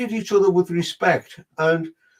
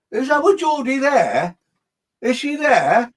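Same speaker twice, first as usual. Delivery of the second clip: high, slow, searching call; anxious old lady; 8 semitones higher, 4 dB louder, slower than usual. Retold or imitated. Spirit grandmother searching anxiously for Jodie, high and slow. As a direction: lean in, worried, calling for her girl.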